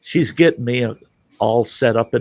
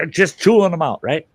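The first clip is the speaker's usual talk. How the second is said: normal talking voice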